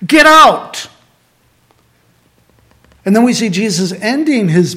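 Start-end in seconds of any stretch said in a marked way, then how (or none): 0.0-0.9 angry command. Retold or imitated. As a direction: drive the money changers out with fury. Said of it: "He's angry. He's frustrated".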